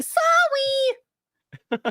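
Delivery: high, cutesy drawn-out "Sorry"; playful Canadian apology. Acted. Guest jokes that she apologizes for everything, playful Canadian apology. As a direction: stretch "Sorry" into a high sing-song "soh-wee". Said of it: "I apologize because I'm Canadian".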